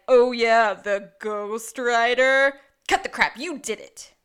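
very loud, slow mock scoff. Gus scoffing at the ghost story, very loud. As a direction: scoff and bark it.

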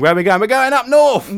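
high, loud rallying cry; excited. Pretend. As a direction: cheer it like a quest. Mock Lord of the Rings quest.

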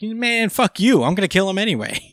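high-pitched, exasperated retold outburst; mock defiant. Code insisting he'll kill him anyway, mock defiant. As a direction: play a fed-up hothead protesting in a high voice. Code defies Ada in acted quarrel.

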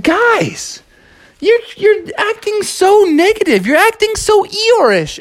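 Froggy high-voiced telling his team off; excited Froggy. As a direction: pitch way up as Froggy. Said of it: "And Froggy said".

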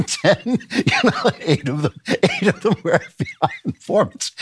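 high-pitched, laughing through the words; real laughter breaking into the sentence; laughing in disbelief. Real, not acted. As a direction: crack up while counting. Laughs at absurd informant count.

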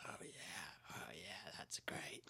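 whispered Vince McMahon impression; breathy whisper of a wrestling boss; hushed, unvoiced McMahon drawl; quiet McMahon. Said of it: "I can't do McMahon quiet".

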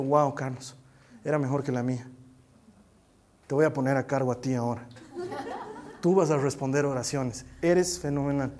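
deadpan pretend praise in a low voice; mock-admiring God. God supposedly praising a believer's idea, mock admiration. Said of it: "Él me ha dicho".

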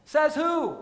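high, loud rhetorical retort; fervent preaching. Acted. Pastor rebutting a listener's self-doubt, loud preaching retort. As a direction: fire it back at the doubt.

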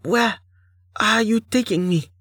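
put-on high, timid young heroine voice; small, scared and hurting; frightened young woman.

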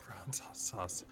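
whispered to self. Real, not acted. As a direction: breathe the name softly. Repeating the name under breath.

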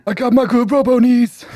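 high-pitched, put-on boastful call; John. Player mimicking absent castmate's robot character talk, high put-on voice. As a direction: push the silly impression up high. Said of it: "That's what he would say".

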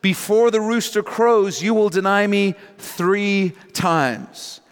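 high-pitched, deliberate pronouncement; emphatic prediction. Retold or imitated. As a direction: declare it firmly, slowing at the end. Jesus's solemn prediction, first reading.

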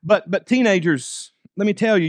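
ordinary talk

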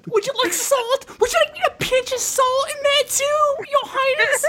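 very high falsetto, sarcastic mock servility; Ray impression. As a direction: squeal the offer in exaggerated outrage. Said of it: "That was a good Ray".

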